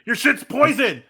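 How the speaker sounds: shouted warning. Acted. Tackler warns princess of poisoned soup, urgent shout. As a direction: shout it over her screaming.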